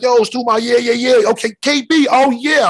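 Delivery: high excited mock Stu on the phone; imitating Stu. Friend imitating Stu picking up a call, high and hyped.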